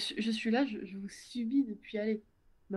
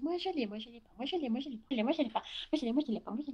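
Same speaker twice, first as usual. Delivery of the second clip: higher, bouncy mock of chattering viewers; mocking sing-song chatter; 5 semitones higher, faster than usual. Streamer mocking viewers bragging about fnaf accounts, higher sing-song. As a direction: parrot the endless back-and-forth, teasing. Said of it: "tout le monde me dise".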